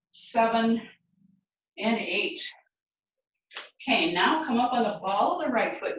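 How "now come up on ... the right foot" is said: usual speaking voice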